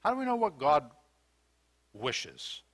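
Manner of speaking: ordinary delivery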